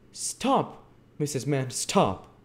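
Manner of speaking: high-pitched commanding halt; show of authority. A narrator's reading. Bumble halting Missus Mann's tirade, authoritative. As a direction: call a halt as a pompous official. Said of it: "with a show of authority".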